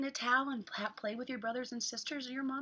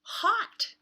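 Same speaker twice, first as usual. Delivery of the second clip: high, loud groan of heat; hot; 11 semitones higher, 10 dB louder than usual. Acted. Sam complaining to friends about the heat, loud and high. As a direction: blurt it loudly as if the sun is blazing. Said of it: "said Sam".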